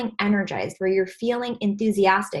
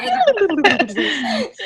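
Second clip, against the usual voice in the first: higher giggling laugh after the shake-up; 11 semitones higher, 4 dB louder than usual. Real, not acted. Coach still giggling after the group sound exercise. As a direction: laugh freely, high. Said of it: "I'm gonna do something kinda silly".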